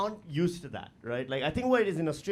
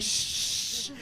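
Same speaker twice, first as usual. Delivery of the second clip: unvoiced shush; imitating a stern shush; mock shush; 2 semitones higher, 4 dB quieter than usual. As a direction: hush the crowd with one shush.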